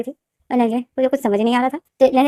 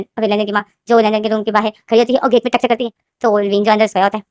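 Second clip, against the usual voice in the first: ordinary delivery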